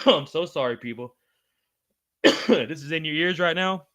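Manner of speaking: talking between real coughs; cough, then push the words out; coughing fit. Real, not acted. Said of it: "I'm coughing".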